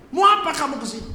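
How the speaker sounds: imitated high, loud challenge; acted shout from far away; father yelling from afar. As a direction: call it out sharply across a distance. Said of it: "Ayah saya dari jauh dia teriak".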